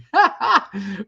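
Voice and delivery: short burst of laughter; real amused chuckle. Real, not acted. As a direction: laugh it off for a second. Laughs at retold jab about marriage.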